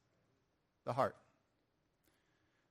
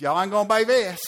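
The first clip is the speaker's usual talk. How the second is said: loud, high Georgia holler; imitating a thrilled Georgia man at full volume; excited Southern accent; 6 semitones higher, 9 dB louder than usual. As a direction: blurt it out in a thick Southern accent. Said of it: "Slow it down".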